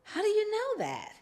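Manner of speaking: high, disbelieving question; incredulous. Retold or imitated. Mother asking her daughter, high incredulous question.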